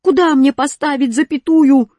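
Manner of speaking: high, loud, eager question; delighted question. A narrator's reading. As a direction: ask eagerly, voice lifted. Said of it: "обрадовался я". (радостно, громко)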